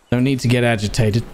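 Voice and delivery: usual speaking voice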